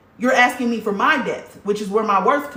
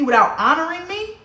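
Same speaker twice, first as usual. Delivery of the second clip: ordinary talk, nothing marked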